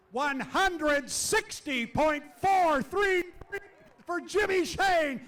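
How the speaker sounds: loud, high race call; shouting the lap home; excited play-by-play. Acted. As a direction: call the run as it happens. Live race call of qualifying.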